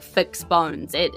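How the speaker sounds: ordinary delivery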